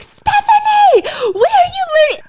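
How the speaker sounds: shocked friend shrieking her name; Tiffany shocked at her boyfriend's phrase, loud shriek; shocked shout. Retold or imitated. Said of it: "She's like".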